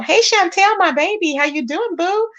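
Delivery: high singsong welcome; excited greeting. Real, not acted. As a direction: gush over a viewer arriving. Greeting viewers joining live.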